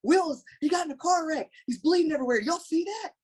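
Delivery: very high-pitched, frantic amazement; imitated panicked awe at a bleeding co-star; freaking out. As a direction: squeal it like a star-struck kid. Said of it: "And I'm freaking out".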